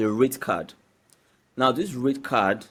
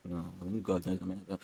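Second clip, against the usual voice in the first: ordinary talk, nothing marked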